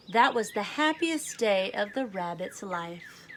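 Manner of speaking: clear, lively storybook read-aloud; children's story narration with gentle emphasis. Acted. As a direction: read it like a picture book to a class. Teacher reads picture book aloud.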